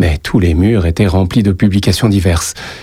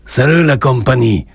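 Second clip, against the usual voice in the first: hint of a Spanish accent, pitched up; 5 semitones higher than usual. A narrator's reading. Joseph greets the café on entering, slight Spanish accent. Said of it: "avec un accent qui dissimulait mal ses origines espagnoles".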